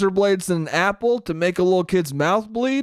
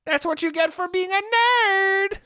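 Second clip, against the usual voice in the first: shrill, much higher gloating granny voice, taken back at once; high-pitched old woman. Acted. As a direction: gloat up high like a cartoon witch. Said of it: "she wasn't high pitched".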